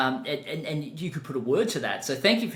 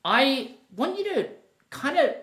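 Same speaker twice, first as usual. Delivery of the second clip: put-on higher, slower broad Australian accent, drawn out; slipping into Australian; 10 semitones higher, slower than usual. Host greeting Australian guest, half-joking broad Australian accent. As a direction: let the Australian accent leak out on mate. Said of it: "my Australian accent comes back".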